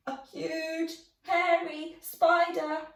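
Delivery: high, loud scared drawl; frightened stammer. Acted. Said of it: "George stammered".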